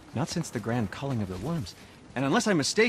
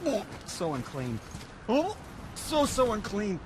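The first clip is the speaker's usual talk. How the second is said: disgusted; 7 semitones higher, 5 dB quieter than usual. Acted. Handling a filthy dragon tooth.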